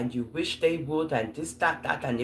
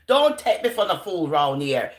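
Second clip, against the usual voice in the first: usual speaking voice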